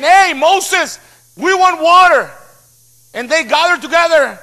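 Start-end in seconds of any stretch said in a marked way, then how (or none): 0.0-2.3 mock crowd calling out, higher pitched; demanding crowd. Thirsty people to Moses, raised demand. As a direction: imitate people hollering at their leader. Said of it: "and shout".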